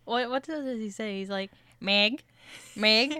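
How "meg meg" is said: loud calling Meg, lightly voiced; a hint of Peter Griffin calling Meg. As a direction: call out for Meg like a cartoon dad. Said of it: "can you give me a Peter Griffin?".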